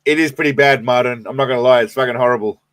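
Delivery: usual speaking voice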